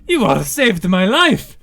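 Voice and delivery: loud, high, overwrought gratitude; frantic relief. Acted. Tofu thanking his rescuers after the dragon chase, frantic relief. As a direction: cry it out in excited relief.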